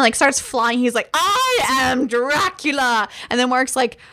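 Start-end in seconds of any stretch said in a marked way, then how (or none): 1.1-3.1 slow, drawn-out mock Dracula declaration; angry Dracula, hammed-up. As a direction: stretch each word like a pompous, enraged vampire. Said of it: "he gets fucking pissed".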